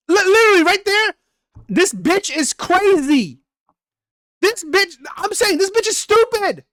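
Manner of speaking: high-pitched exasperated venting; exasperated rant. Real, not acted. Streamer ranting about the woman's messages, high exasperated venting.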